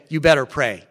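loud, firm scolding command; firm order from the friend to stay and work; emphatic. Retold or imitated. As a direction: lay down the law like a stern friend. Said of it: "And he's very emphatic".

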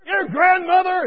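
high, loud, drawn-out insult; imitated yelling. Patient still hurling insults at the nurse, loud yell. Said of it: "He's still yelling".

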